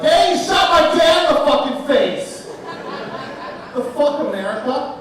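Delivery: hammed-up furious son; mock outrage. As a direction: shout it like a betrayed son. Son's imagined outraged reaction.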